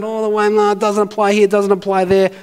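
high, rapid-fire objections on one pitch; making excuses. Acted. Preacher mimicking own objections to the passage, protesting. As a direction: reel off the protests without a breath. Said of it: "given all the if, buts, and maybes".

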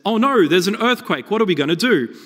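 hammed-up loudspeaker panic; panicked announcement voice. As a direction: act out a ride announcer crying out in alarm. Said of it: "over the loudspeaker, a voice says".

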